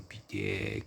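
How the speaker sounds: pitié whispered, breathy and very low. Shown as a demonstration. Vlogger groaning pitié at the remake. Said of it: "Faut pas dire pitié".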